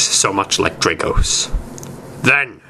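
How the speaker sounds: plain reading voice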